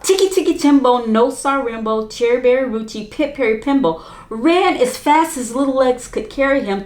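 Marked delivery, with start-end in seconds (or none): none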